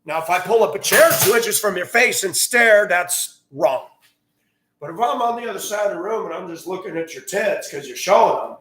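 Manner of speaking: normal talking voice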